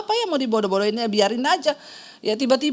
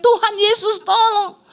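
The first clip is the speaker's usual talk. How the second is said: higher, slowed mock yell of Tuhan Yesus tolong; retold cry for help; 7 semitones higher, slower than usual. Husband seeing a speeding car, half-acted cry for help. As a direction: cry it out to God as danger comes. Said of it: "Makanya dia teriak".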